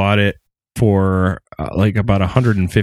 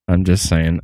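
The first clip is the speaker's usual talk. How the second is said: usual speaking voice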